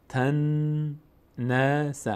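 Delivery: very slow "تنس" with long nasal n; ghunnah. Shown as a demonstration. Teacher showing doubled noon, n held and hummed nasally. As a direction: hold the n of "تنس" through the nose.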